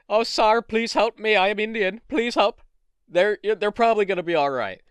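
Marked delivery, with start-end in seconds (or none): 0.1-2.6 lightly voiced high pleading, louder; mock plea for help, pitched up; mock pleading caller. People begging police for help, mock pleading. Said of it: "the people going".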